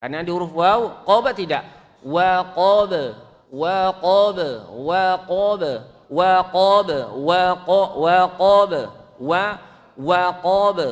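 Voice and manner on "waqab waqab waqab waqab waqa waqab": waqab with lips rounded on wa, louder; loud drilled waqab. Shown as a demonstration. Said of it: "Wa yang monyong".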